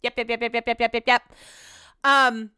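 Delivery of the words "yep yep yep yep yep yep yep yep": mock husband's machine-gun yeses; rapid-fire agreeing. Husband asked about running electric, brushing it off rapid-fire. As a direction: rattle off the same word in a quick, even string. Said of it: "Brett was like".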